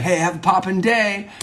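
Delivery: high, chipper mock goodbye; chipper farewell. Monologist playing a neighbour saying bye in the building, chipper. Greeting someone in his building.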